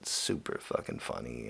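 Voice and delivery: normal talking voice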